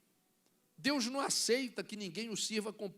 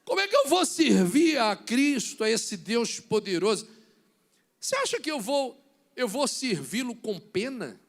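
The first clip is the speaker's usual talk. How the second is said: preaching at full force; 9 dB louder than usual. Real, not acted. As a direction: challenge the church loudly. Pregação em voz forte. (pregação forte)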